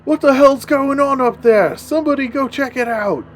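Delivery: high-pitched character yell from downstairs; acted shout. Game master voices cultist downstairs hearing a racket, acted shout.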